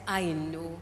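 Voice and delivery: low, soft acknowledgement; understanding master. Retold or imitated. The master talking to the cup, low and understanding. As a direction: drop low and quiet, with sympathy. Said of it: "master talks to the cup and says".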